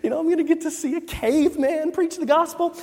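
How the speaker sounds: high-pitched eager anticipation, retold; lightly acted childlike excitement; excited kid. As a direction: play a kid who can hardly wait. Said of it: "I'm so excited. I'm like".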